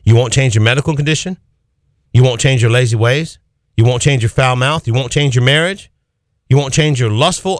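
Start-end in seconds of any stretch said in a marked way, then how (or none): none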